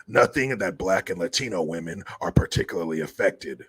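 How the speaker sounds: low raspy mock reading of his statement; Newsom impression. Mocking Newsom's signing message, low rasp. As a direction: read the quote in a low sleazy rasp. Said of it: "He said".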